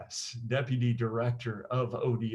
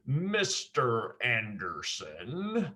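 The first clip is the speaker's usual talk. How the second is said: Matrix agent voice; 2 semitones lower, 4 dB quieter, much slower than usual. Retold or imitated. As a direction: stretch out every syllable like a cold film agent. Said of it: "one of the agents talks like this".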